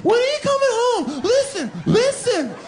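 very high, loud clingy falsetto; needy girlfriend. Acted. Nagging girlfriend pleading with her man as he leaves, in a loud shrill falsetto. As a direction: whine it like a clingy partner at the door.

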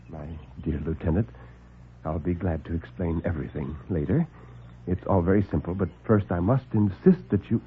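low, soft, smooth doctor; calm the men down, unhurried and superior; suave doctor. Acted. Doctor soothes the alarmed lieutenant, low and suave.

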